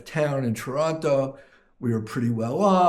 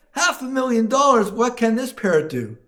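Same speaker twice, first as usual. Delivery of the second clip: very high, louder outburst of disbelief; stunned customer; 9 semitones higher, 4 dB louder than usual. Acted. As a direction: peak of the joke's escalating shock. Buyer shocked at highest price.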